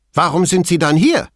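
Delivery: high, loud, heated question; agitated. A narrator's reading. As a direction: demand it indignantly. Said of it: "fragte Veltrini aufgebracht". (aufgebracht, laut und hoch)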